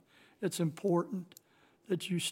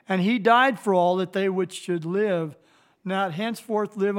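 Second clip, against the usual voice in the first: louder, preaching the verse out to the congregation; forceful scripture reading; 6 dB louder than usual. Acted. Pastor reading Second Corinthians aloud to the church, forceful and loud. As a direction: proclaim it with full pulpit force.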